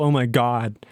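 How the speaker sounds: normal talking voice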